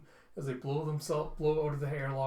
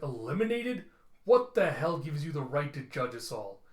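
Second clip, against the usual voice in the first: raised, indignant challenge; acted outrage; 5 dB louder than usual. Outraged reply to judgment.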